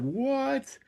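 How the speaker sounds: high, softly squeaked what; mock disbelief. Reacting to the casting fact, high incredulous what. Retelling his stunned reaction to trivia.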